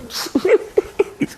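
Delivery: very high, breathless giggle; imitated maid's giggle. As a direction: mimic a helpless squealing laugh. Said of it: "itu dia ketawa". (tawa cekikikan menirukan pembantu)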